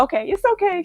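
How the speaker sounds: high-pitched imitation of a tickled stranger; retold amusement. Lady turning round after laughing at the mother, high amused reassurance. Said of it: "she laughed so hard".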